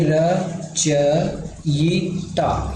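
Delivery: रचयिता very slowly, "ra-cha-yi-ta"; spelling out. Shown as a demonstration. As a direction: dictate रचयिता syllable by syllable. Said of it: "रचयिता कैसे लिखते हैं". (रचयिता को अक्षर-अक्षर धीरे बोलना)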